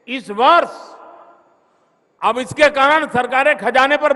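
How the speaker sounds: high, loud orator's cry; booming political rally speech. Real, not acted. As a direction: thunder it out to the crowd.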